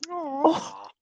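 high, sweet apology to the dog; cooing at a dog. Acted. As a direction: coo your sorry to the poor boy.

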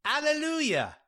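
high, drawn-out cry of praise. A narrator's reading. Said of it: "the others cried out".

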